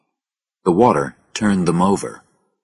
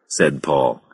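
usual speaking voice

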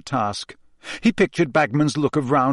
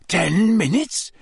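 croaked; 6 semitones higher, slower than usual. Acted. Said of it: "Harry croaked".

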